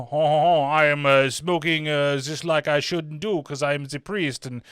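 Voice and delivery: hammed-up impression of a smoking priest; mock priest voice. Reviewer mocking the priest sneaking a cigarette, hammed-up character voice. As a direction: put on an exaggerated, silly priest voice. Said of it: "he doesn't really talk like that".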